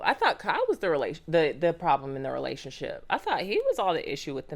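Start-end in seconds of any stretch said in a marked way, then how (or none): none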